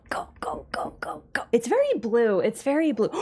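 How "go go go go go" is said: breathy whispered go go; whispering. Real, not acted. Whispered urging.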